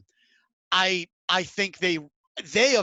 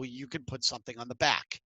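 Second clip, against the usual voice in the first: ordinary talk, nothing marked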